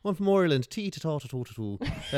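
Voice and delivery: high, lilting Republic of Ireland accent; mellow southern Irish. Shown as a demonstration. Imitating Hollywood-film Irish for friends, mellow Irish accent. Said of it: "it's a lot more mellow".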